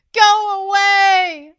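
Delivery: high, loud, slow anguished shout. A narrator's reading. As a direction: shout it with all she has. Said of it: "Zin shouted".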